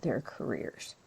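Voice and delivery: ordinary delivery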